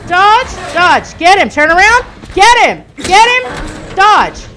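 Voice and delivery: loud, high barked orders; frantic commands. Real, not acted. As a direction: yell at him to fight.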